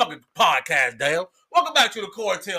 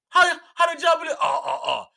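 excited shout; 8 semitones higher than usual. Real, not acted. Stammering excited outburst.

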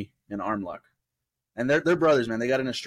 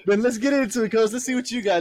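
hype; 12 semitones higher, 6 dB louder than usual. Real, not acted. Hyping up the next pick.

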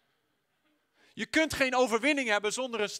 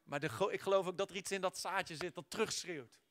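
ordinary talk

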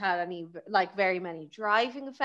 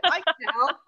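high, eager little digger voice; tiny little digger; 5 semitones higher, 5 dB louder than usual. Acted. Tiny digger offering help beside the massive ship, mock-plucky and higher. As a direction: pipe up like a tiny helpful digger. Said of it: "this tiny little digger at the bottom being like".

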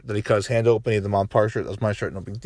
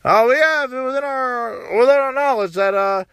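high, slow halting mock of Biden; mock of Biden hunting for words; Biden impression; 9 semitones higher than usual. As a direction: stumble through it like an old politician. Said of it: "he went up there".

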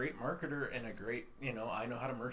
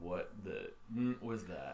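deep booming voice; 3 semitones lower, slower than usual. Retold or imitated. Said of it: "with his deep booming, like, baritone voice".

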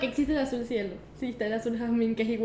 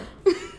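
short burst of laughter. Real, not acted. Player laughs over whether juice is an object, amused. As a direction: break into a laugh.

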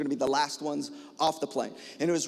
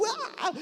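mock scream; 5 dB quieter than usual. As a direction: squeal as if flung through the air. Imitates own reaction being swung around.

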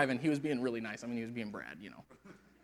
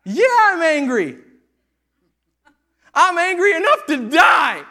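slow, loud, high furious yell; furious shout; 18 dB louder, slower than usual. Acted. Jonah shouting back at God over the plant, furious. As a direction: shout it like a sulking prophet. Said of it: "That's what he says".

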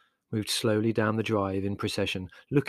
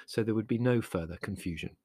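ordinary delivery, nothing marked

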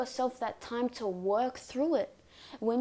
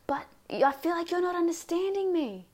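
higher, louder exasperated complaint; frustrated protest; 7 semitones higher, 4 dB louder than usual. Acted. Triggered person finally saying what has built up, frustrated.

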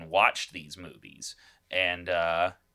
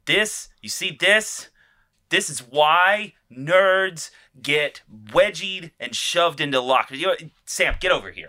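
mock outrage; 9 semitones higher, 10 dB louder than usual. Mock outrage at elf-speaking nerds.

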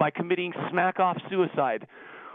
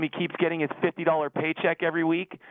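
ordinary talk, nothing marked

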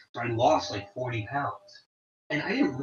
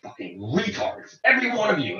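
mock teacher's tirade, rising higher at the end; hard-ass teacher; 8 semitones higher, 4 dB louder than usual. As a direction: imitate a harsh teacher berating the class. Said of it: "hard asses where if she screamed at you".

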